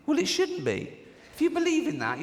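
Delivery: high, indignant comeback; indignant retort. Real, not acted. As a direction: rise up in outrage. Heated retort to critic.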